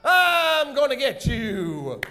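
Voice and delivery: long, drawn-out menacing holler; villain's yell. Shown as a demonstration. Villain leaping at the crowd, slow hammy yell. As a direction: stretch it out slowly as a villain's threat. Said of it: "and yell out".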